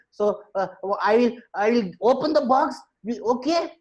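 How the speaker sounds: high-pitched, halting agreement; hesitant monkey. Acted. Monkey agreeing to open the box, hesitant.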